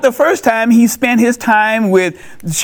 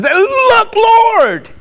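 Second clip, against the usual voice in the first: "Look, Lord" said much higher and ringing; exclaiming; 12 semitones higher than usual. Retold or imitated. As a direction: cry out to the Lord in wonder. Preacher repeats the words with emphasis.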